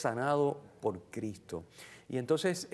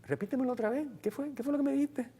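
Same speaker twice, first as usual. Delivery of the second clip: Jesus asking; 10 semitones higher than usual. Retold or imitated. Jesus voiced asking again.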